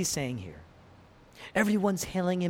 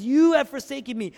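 high, loud cry of accusation; anguished crying out; 9 semitones higher, 7 dB louder than usual. Acted. Preacher voices Jesus on the cross, crying out. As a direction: cry out as if abandoned. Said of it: "he's crying out".

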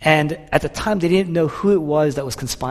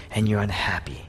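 low, whispery drop; hushed sorrow; 6 semitones lower, 7 dB quieter than usual. Acted. Jesus telling Martha she is unhappy, hushed. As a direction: let it fall away almost to a whisper.

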